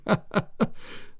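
airy high laugh; breathy laugh. Acted. Dad laughing at his own joke, airy. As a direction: chuckle under the breath.

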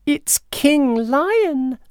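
high, squeaky little mouse voice; slow, high-pitched tiny mouse. A narrator's reading. Cheerful mouse talking to himself on spotting the lion, squeaky voice. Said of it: "the little mouse said to himself".

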